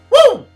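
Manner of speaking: very high, loud whoop; meth-high whoop. Acted. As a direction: let out a wild, piercing "woo". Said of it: "a degenerate on a three-day meth high".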